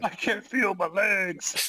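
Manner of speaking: high, slow anguished quote; dramatic anime cry. Acted. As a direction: wail it like a paralysed anime hero. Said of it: "I really am Johnny Joestar".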